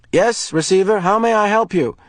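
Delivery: high, bright service voice; a polite voice over the wall speaker. Acted. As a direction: official answering the intercom. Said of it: "the voice said".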